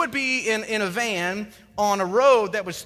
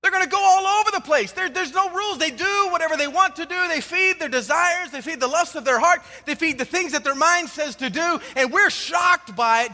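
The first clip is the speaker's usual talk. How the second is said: higher, louder, slowing to drive each point home; fired-up preaching; 6 semitones higher, 3 dB louder than usual. Acted. Pastor preaching to the congregation about road rage, higher and louder. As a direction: preach it with rising fire.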